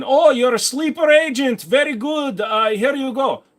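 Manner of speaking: playful put-on Russian accent, pitched high; hammed-up Russian agent voice; mock Russian accent. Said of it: "With your Russian accent".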